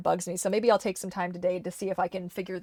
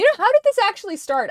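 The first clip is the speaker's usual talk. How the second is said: mock puzzled voice; 13 semitones higher, 7 dB louder than usual.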